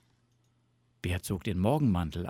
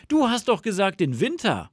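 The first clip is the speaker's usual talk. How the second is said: high, loud protest; indignant fox; 10 semitones higher, 6 dB louder than usual. A narrator's reading. Fox catches Bear changing his mind, indignant. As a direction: object loudly in a high fox voice.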